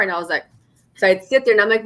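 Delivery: ordinary talk, nothing marked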